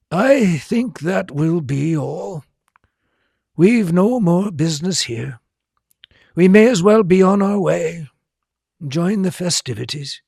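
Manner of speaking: lightly voiced genial headmaster; Dumbledore voice. A narrator's reading.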